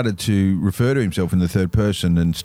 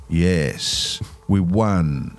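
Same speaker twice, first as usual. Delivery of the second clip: emotionless; 4 semitones lower, much slower than usual. Retold or imitated. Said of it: "With no emotion whatsoever".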